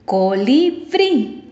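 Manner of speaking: "Colibrí" slowly sounded out, force on final "brí"; stress on last syllable. Shown as a demonstration. Teacher showing the last-syllable rule, slow stressed "brí" in "Colibrí". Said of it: "Esta lleva la fuerza de voz".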